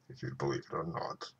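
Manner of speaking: soft, quick aside under the breath; muttered aside. Real, not acted. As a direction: throw it away quietly.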